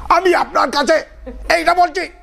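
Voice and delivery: very high-pitched mock oratory; strained, high declaiming in imitation; hyped-up Bengali preacher. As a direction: parody a Bengali firebrand at full pitch. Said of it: "like in Bengali, they might say".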